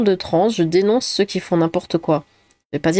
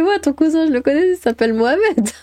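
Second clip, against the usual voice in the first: high, mocking voice of the streamer; imitating the girl; 8 semitones higher than usual. Streamer retells the girl's reply to the guy, high and mocking. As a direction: retell her comeback in a pitched-up mock voice. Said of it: "Elle fait".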